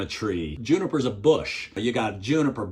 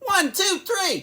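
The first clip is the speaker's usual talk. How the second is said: very high, playful count; squeaky-high call of each number; counting out dashes. Pretend.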